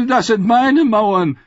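high, loud, slow indignant protest. Acted. Lord Ammon protesting to the council, high and indignant. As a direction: claim the walls as his, affronted. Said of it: "protestierte Lord Ammon".

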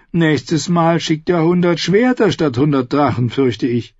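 high, smooth mock-polite outlaw singer; sweetly polite while threatening; silky false courtesy. Singer figure voiced.

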